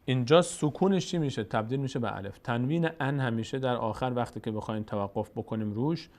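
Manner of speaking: normal talking voice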